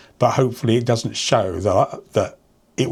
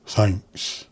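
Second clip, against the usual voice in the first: Stephen Wright deadpan; 4 dB quieter than usual. Retold or imitated. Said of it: "come on stage and everyone would clap".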